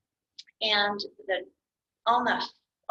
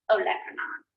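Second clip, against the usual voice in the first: whispered demo. Shown as a demonstration. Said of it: "emphasis on that middle or that second syllable".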